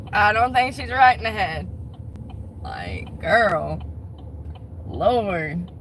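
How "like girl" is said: normal talking voice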